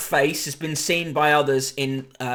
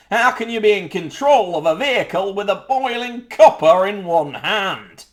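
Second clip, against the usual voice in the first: higher, louder put-on voice of an outraged reader; mock indignant reader; 6 semitones higher, 5 dB louder than usual. Host sending up a reader's coffee complaint, hammed-up indignant voice. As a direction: ham up the outrage of a fussy motorist.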